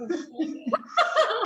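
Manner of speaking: high delighted laugh; loud high laugh; burst of laughter. Real, not acted. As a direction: really crack up.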